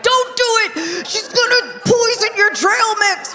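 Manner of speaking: high, urgent warning voice; panicked inner brain. Retold or imitated. Husband's brain warning him awake, high urgent voice. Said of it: "your brain is like".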